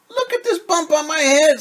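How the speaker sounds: high, loud indignant hen voice; insist, pointing at the bump; insistent hen. Acted.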